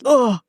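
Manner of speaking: put-on sulky teenager's annoyed groan; teen groan. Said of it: "bouncing almost like".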